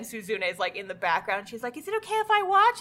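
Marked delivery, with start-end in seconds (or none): 1.8-2.8 high, quick little question; imitating an anime girl asking permission; eager girlfriend. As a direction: pipe up fast and high. Voicing timid girlfriend's request.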